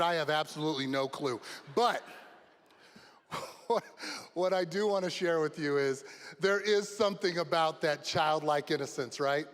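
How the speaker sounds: normal talking voice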